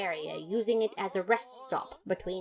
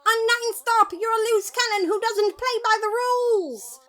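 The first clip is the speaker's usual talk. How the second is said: much higher, louder yell; 8 dB louder than usual. Acted. Papyrus restraining Undyne and yelling into her ear. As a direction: shout right into her ear. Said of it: "I yelled".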